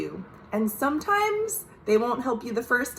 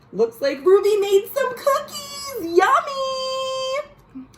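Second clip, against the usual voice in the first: very high, relishing "Yummy"; delighted yummy. Acted. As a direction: savor it like a kid seeing treats. Reader relishing cookies.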